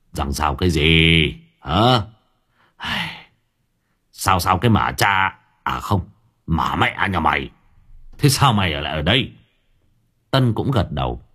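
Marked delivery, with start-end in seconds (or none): 0.1-9.3 scolding. A narrator's reading. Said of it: "Ông Đức mắng".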